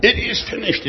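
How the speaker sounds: high-pitched triumphant declaration; cry of victory. Shown as a demonstration. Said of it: "is a cry of victory".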